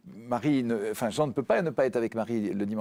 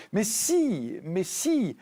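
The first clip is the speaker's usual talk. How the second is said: high, drawn-out emphatic protest; indignant rebuttal; 8 semitones higher, much slower than usual. Retold or imitated. Answering those who forbid tears at funerals, indignant. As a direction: contradict insistently, pitch leaping up on "si". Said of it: "je suis furieux quand on nous dit".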